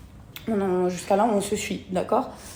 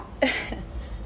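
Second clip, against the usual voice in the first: short breathy laugh; 4 semitones higher, 6 dB quieter than usual. Real, not acted. Breathy laugh after saying it's fine.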